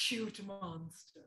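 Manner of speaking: soft, slow cutesy voice after a gasp; mock adorable monster. Shown as a demonstration. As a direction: breathe it out sweetly. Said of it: "One more time".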